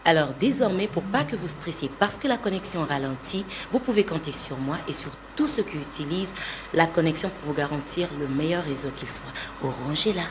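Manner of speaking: soft, measured commercial read; advert voice-over. Shown as a demonstration. Voice artist performing a recent telecom spot on request, soft advert read. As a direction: reassure customers in a soft ad voice. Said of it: "Essayez d'abord quelque chose que vous avez déjà fait".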